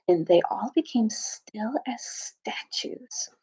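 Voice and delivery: slow hushed whisper; storyteller dropping to a whisper; whispered narration. A narrator's reading. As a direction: breathe the words so nothing stirs.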